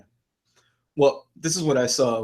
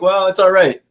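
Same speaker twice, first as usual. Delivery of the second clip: higher and louder, breezy dismissive shrug; mock shrug; 6 semitones higher, 7 dB louder than usual. Mocking viewers who shrug off violence, breezy and dismissive. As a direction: brush it off like it's nothing, mockingly. Mocking what people think.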